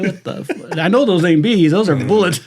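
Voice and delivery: ordinary talk, nothing marked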